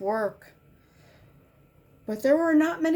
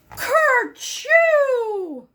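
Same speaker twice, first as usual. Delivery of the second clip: a giant sneeze, much higher and louder; the biggest storybook sneeze ever; huge sneeze; 12 semitones higher, 7 dB louder than usual. A narrator's reading. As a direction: let the sneeze explode. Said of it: "Robert sneezed".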